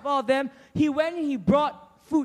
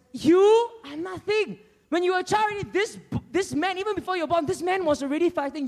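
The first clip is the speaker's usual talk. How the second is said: high, emphatic belittling; intimidating put-down; 8 semitones higher than usual. Acted. King doubting the young shepherd, scornful intimidation. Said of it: "Another voice of intimidation".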